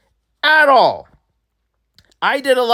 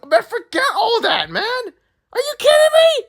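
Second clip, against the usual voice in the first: much higher, squeaky laughing outburst; laughing disbelief. Real, not acted. Ranting about the awards loss.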